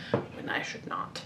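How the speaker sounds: breathy self-scolding whisper; whispered aside. Real, not acted. Scolding herself for digressing, whispered. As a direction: whisper it to yourself.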